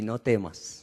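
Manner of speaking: hushed "no temas". Shown as a demonstration. Preacher repeating God's words softly to the congregation. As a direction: let "no temas" sink to a hush. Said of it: "Dos palabras importantes".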